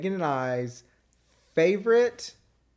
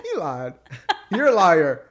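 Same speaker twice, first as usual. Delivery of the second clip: loud laughing you're a liar; laughing accusation; 7 semitones higher, 7 dB louder than usual. Real, not acted. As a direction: call her a liar while cracking up. Laughing accusation after the joke.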